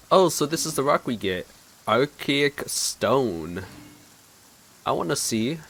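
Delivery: usual speaking voice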